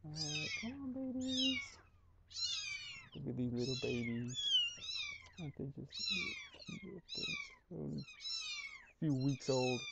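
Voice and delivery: high cooing pet voice. Real, not acted. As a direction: coo softly to tiny kittens. Cooing to newborn kittens.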